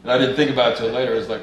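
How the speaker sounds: ordinary talk, nothing marked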